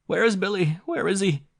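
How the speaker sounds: high, loud, frantic cry; mother running to meet the children; breathless cry. A narrator's reading. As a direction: call out in panic. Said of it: "cried the mother, nearly breathless".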